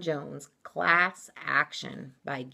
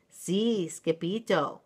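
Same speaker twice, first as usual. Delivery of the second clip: ordinary delivery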